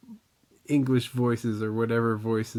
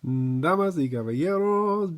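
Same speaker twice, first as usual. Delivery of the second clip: lucha libre announcer. Acted. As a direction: stretch bienvenidos like a wrestling MC. Show opening announcement.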